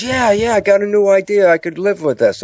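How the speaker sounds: high, excited mock enthusiasm; eager revelation. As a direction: voice a mind thrilled by its new idea. Conscious mind acting excited over idea.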